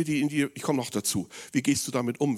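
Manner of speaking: ordinary delivery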